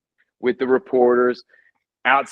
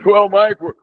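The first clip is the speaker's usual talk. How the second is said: higher, louder smooth host voice; polished TV host; 7 semitones higher, 6 dB louder than usual. Retold or imitated. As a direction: play the slick anchor trying to calm things. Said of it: "he's this polished guy".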